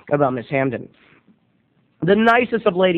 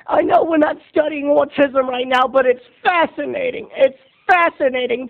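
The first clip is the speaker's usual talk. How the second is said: much higher, gushing teacher; 6 semitones higher than usual. Retold or imitated. Teacher thrilled to announce a movie to class, high gushing. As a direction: play the giddy teacher announcing a treat. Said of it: "Missus Hamden says".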